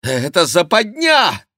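high, loud cry of alarm; alarmed outcry. A narrator's reading. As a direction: cry out as if trapped. Trapped king protests.

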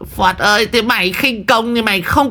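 put-on high, whining old shaman; whimpering. A narrator's reading. Old shaman whining at the exorcist who leapt away. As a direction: whine on the verge of tears. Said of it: "Đồng già mếu máo".

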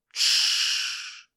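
long unvoiced shushing hiss. A narrator's reading. Captor silences the crying son, hissing. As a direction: hush them with a hiss. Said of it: "зашипел мужчина".

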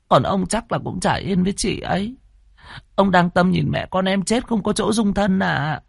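high, fearful pleading; frightened young woman. A narrator's reading. Maid begging the landlord not to abandon her. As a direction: beg high and scared.

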